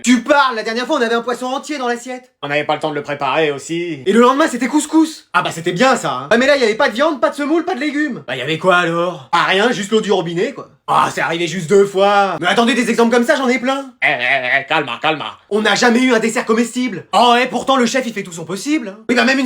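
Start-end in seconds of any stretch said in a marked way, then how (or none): none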